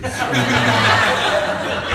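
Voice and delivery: a burst of real laughter. Real, not acted. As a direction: laugh out loud at your own line. Laughs at his own joke.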